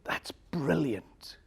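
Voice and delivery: slow, whispered realization; hushed amazement. Retold or imitated. Rich man to the soldier who stopped him, awed whisper. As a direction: breathe it out in a whisper, struck by the idea.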